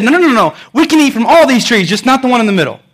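emphatic, self-assured rebuttal; confident Eve. Acted. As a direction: set them straight with total assurance. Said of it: "The confidence".

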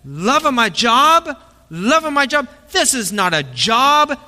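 high, slow, proud declaration; lightly voiced intense baker; soup Nazi baker. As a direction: proclaim it like a stern, fervent baker. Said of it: "this soup Nazi".

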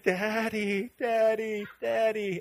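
high, held imitation of a child calling; crying toddler. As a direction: mimic a toddler's cry for dad. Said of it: "This time she says".

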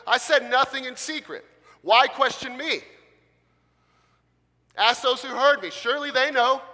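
high, loud, indignant exclamations; indignant shouting Jesus. Shown as a demonstration. Wrong tone demo, Jesus shouting back at the high priest. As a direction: bark each line like an offended man. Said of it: "Here's the tone that I don't get from Jesus".

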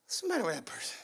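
low, hushed aside; puzzled bystander. Acted. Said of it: "the person beside you goes".

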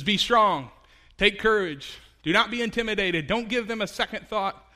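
rally them like a commander; rousing command. Acted. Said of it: "It says".

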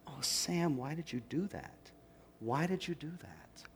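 unvoiced whisper, scolding oneself; self-reproachful whisper. Preacher voicing anxious inner self-blame, whispered. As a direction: hiss the blame at yourself under your breath.

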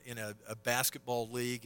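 ordinary delivery

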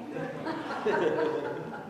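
breathy unvoiced chuckle; real soft laugh. Real, not acted. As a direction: laugh quietly to yourself. Laughs at kids' antics.

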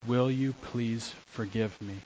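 soft, sincere apology; modelled apology. Shown as a demonstration. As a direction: ask it humbly and softly. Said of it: "use the words".

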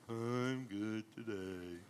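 low, slow, soft mopey donkey; Eeyore mope. Retold or imitated. Eeyore answering a cheery friend, low and slow. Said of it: "mope around like Eeyore".

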